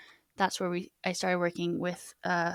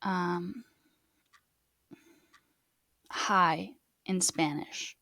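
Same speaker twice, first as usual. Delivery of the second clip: slow learner question; much slower than usual. Shown as a demonstration. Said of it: "if I say".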